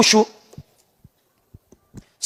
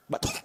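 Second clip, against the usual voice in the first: faked cough; 14 dB quieter than usual. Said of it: "Tuh, batuk".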